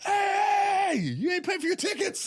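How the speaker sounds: high, loud mock security shout; security guard yelling. Security guard stopping him at the door, loud mock yell. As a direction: bark it like a bouncer stopping you. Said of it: "I was like".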